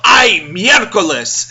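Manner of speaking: slow, loud, drawn-out "Ay, miércoles!"; mock teenage yell at falling books; imitated yell. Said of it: "he's just gonna yell".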